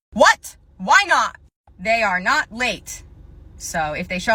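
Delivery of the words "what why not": loud disbelieving laugh then a louder, indignant why not; incredulous customer. Acted. Entitled customer refused compensation, loud indignant disbelief. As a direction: scoff in outrage at being refused.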